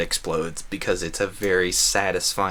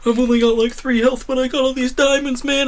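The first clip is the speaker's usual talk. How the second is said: imagining fleeing the skeleton, high panicked squeal; 5 dB louder than usual. Retold or imitated. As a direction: squeak it out like a panicking player clutching diamonds. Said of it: "running outta here like".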